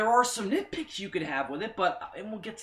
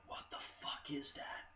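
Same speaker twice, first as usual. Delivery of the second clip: hushed, breathy whisper; awed whisper; 17 dB quieter than usual. Retold or imitated. Fan seeing the silhouette, awed whisper. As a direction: whisper it in awe.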